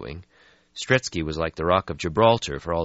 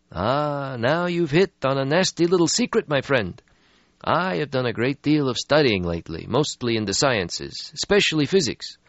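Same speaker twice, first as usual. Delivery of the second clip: put-on weary old vampire; 9 semitones higher, 4 dB louder than usual. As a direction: sigh out an ancient regret. Stretsky voiced in story.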